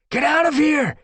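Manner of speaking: very high, loud screech. Acted. Brambleclaw ordering Squirrelpaw away, loud screech. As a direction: screech the order over the chaos. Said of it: "Brambleclaw screeched".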